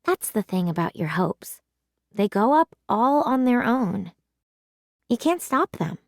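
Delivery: ordinary delivery, nothing marked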